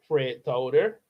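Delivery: ordinary delivery, nothing marked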